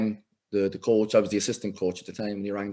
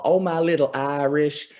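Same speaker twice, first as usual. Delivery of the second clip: slow, higher, cooing women's voice; 6 semitones higher, slower than usual. Retold or imitated. The American women cooing over him, slow and higher. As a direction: croon it fondly, lightly. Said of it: "All the women would call".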